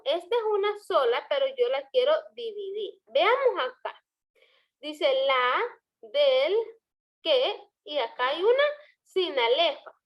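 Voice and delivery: normal talking voice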